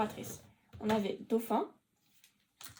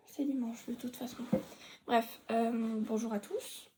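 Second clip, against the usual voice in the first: ordinary talk, nothing marked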